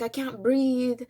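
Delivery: slow panicked plea. Retold or imitated. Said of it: "I was like".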